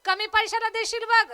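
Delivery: high, loud warning yell; shouting. Acted. Husband calling from window to wife, shouted warning. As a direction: holler after her out of the window. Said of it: "पाहून ओरडायला लागला".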